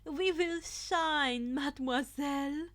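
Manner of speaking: slow, loud flamboyant declaration; theatrical cheer. A narrator's reading. Aoyama to his partner Uraraka, slow flamboyant cheer. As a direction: proclaim it like a dandy. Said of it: "Aoyama cheered".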